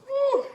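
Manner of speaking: high, loud "woo!" yelled out from the spice; burning-mouth yell. Real, not acted. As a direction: let out a loud pained whoop as the heat hits. Spicy food burning, yelling out.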